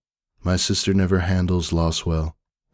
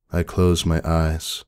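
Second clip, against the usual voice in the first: ordinary delivery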